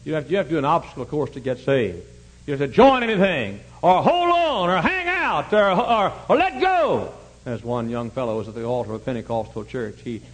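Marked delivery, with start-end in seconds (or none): 2.5-7.1 building emphasis. Real, not acted. Preacher builds mocking list of demands.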